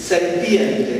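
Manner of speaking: "serpiente" stretched out very slowly and stressed; very slow emphasis. Shown as a demonstration. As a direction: lean on "serpiente" as a proper name. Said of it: "Por eso no dice la serpiente". ("serpiente" muy lenta y recalcada, como nombre propio)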